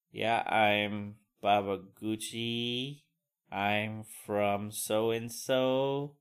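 slow, hesitant, mumbled self-introduction; awkward shy mumble. Retold or imitated. New kid introducing himself to class, slow awkward mumble. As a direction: drag it out like a nervous new kid.

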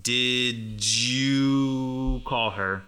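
"Did you" drawn out slowly as "didjooo"; very slow, linked "didjoo call her"; connected D and Y. Shown as a demonstration. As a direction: stretch the J sound and vowel in "Did you". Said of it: "the D and the Y, they connect".

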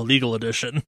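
usual speaking voice

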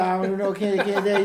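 slurring mock rapper lines; slurred rapper impression. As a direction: imitate slurred mumbled rap. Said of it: "he's slurring his words".

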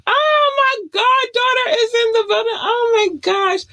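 loud high squeal of delight; thrilled high-pitched yelling; excited shriek. Real, not acted. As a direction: shriek with joy at the goddaughter. Goddaughter joins the stream.

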